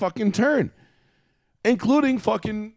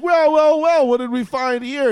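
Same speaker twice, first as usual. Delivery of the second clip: higher and louder gloating cop; smug cop; 8 semitones higher, 5 dB louder than usual. Retold or imitated. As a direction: crow over the find like a smug cop. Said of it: "And he's like".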